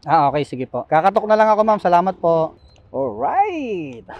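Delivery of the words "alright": a rising, sing-song "Alright!" called out; rider's catchphrase. Pretend. Delivery rider mimicking other riders, sing-song alright. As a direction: toss off "Alright!" with a lift at the end. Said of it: "Sino ba nagpauso ng alright?".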